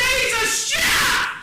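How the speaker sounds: high, slow, very loud yell; exasperated, drawn-out outburst; incredulous shouting. Acted. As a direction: bellow it in comic disbelief.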